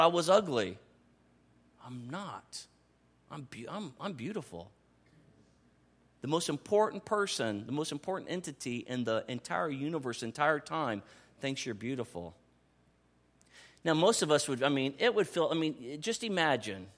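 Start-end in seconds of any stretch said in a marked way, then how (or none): 1.9-4.7 soft, almost breathless realization; hushed wonder. Retold or imitated. Pastor voicing himself discovering he is beautiful. 6.2-12.3 softer, lower and intimate; hushed tender. Real, not acted. Pastor tenderly telling the congregation they are loved.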